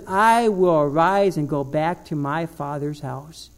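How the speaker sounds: low, slow, hushed declaration; solemn resolve. Acted. Preacher as the prodigal pig deciding to go home, mock-solemn.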